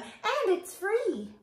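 high, drawn-out merry voice; laughing bug. A narrator's reading. Bug invites the bird aboard, laughing voice. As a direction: stretch it out high and gleeful. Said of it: "laughed the bug".

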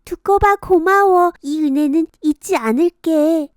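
put-on high, bright thankful girl; grateful girl. Kongjwi thanking the toad, high grateful voice. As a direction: thank him sweetly.

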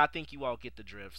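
ordinary talk

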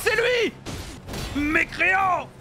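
high, exclaimed accusation; mock-heroic denunciation. Player denouncing a miscreant, mock-heroic shout. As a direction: cry it out like a challenge.

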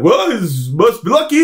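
slow, high, airy mock of a clueless owner; mocking how baseball owners think, slow and high. Mocking a naive sports owner.

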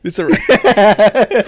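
high, slow, loud drawn-out line; high, slow hammed-up soldier voice. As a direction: stretch it out like a goofy soldier. Joking German soldier voice.

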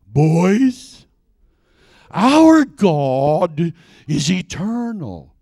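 imitating a teacher, slow and drawn out; captivating teacher inflection. Sunday school teacher to her boys, imitated with captivating inflection. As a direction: stretch each word as a spellbinding teacher would. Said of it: "with that inflection in her voice".

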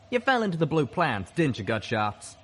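pointed, wry comeback that drops at the end; wry snapping. A narrator's reading. Calamity snaps back at Gutshot in a heated argument, wryly. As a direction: snap back wryly, starting high and loud. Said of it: "Calamity snapped back wryly".

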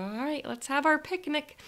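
playfully excited, pitched well up; playful eagerness. Retold or imitated. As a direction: pipe up like a kid ready for a picnic. Acting like little kids at picnic.

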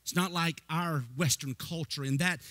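ordinary talk

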